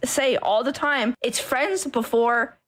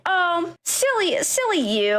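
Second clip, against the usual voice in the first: slow, much higher mock-sweet brush-off; mocking dismissal; 7 semitones higher, slower than usual. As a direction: sneer it like a patronizing shrug. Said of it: "people would be like".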